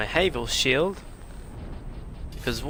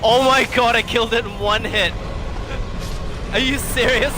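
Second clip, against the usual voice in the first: loud, high amazed cry; excited shout; 7 semitones higher, 6 dB louder than usual. Real, not acted. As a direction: yell it in disbelief. Amazed after one-hit kill.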